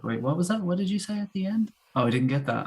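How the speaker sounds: acted-out puzzlement, rising on the question; puzzled listener. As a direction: play a listener who missed the ending. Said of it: "you kind of think".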